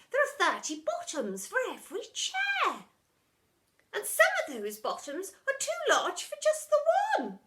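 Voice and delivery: high, prim retort; prim old headmistress. A narrator's reading. Headmistress answering the deputy, high and prim.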